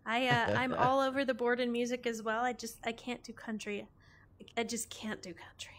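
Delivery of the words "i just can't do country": whispered guilty confession. Real, not acted. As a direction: whisper it like a secret. Whispered confession about country music.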